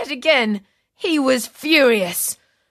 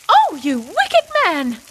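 high, slow shocked scolding; shocked wife; 8 semitones higher than usual. A narrator's reading. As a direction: gasp out the rebuke.

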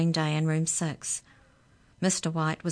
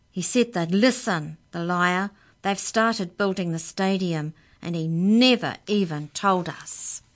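louder furious rant; 2 semitones higher, 6 dB louder than usual. Acted. Amy rants to Jake on the phone, furious and louder. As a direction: spit out the betrayal. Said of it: "launched into furious words".

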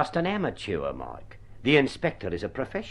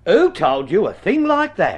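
indignant; 6 semitones higher, 8 dB louder than usual. Acted. Indignant at being accused.

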